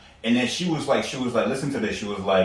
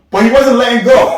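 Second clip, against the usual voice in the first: higher, louder excited build; 11 semitones higher, 12 dB louder than usual. Real, not acted. Coworker hug in the hallway, excitedly amused. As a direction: burst out with amused disbelief. Laughing retelling of long hug.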